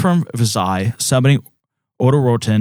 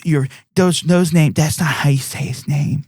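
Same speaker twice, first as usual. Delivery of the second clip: nitpicky commenter; 5 semitones higher than usual. Retold or imitated. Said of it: "that's gonna comment".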